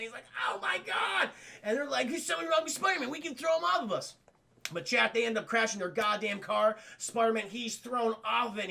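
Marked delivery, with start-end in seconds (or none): 0.5-1.3 high, pained outcry; acted pain. Hero struck by kidney pain. 2.1-4.2 high-pitched urgent alert; mock-urgent shout to partners, pitched up; excited crooks. Crooks notice hero is struggling.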